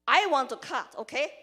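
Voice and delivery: ordinary talk, nothing marked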